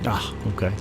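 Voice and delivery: usual speaking voice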